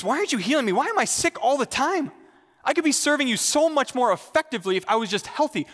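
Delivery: high-pitched acted complaint; frustrated. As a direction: plead with Jesus in exasperation. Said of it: "in frustration and say".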